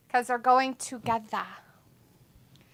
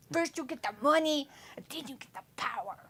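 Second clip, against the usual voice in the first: Tony Montana impression; 8 dB quieter than usual. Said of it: "Say it like him".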